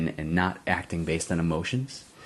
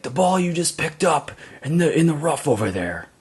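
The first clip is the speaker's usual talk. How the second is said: angry retort; 9 semitones higher, 4 dB louder than usual. Retold or imitated. Golfer insists angrily to dad.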